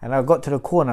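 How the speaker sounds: normal talking voice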